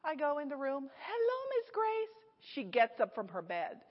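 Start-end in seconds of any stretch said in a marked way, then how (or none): 1.0-2.2 bright, much higher singsong hello; cheerful greeting. Retold or imitated. Nurse greeting her new patient, high bright singsong.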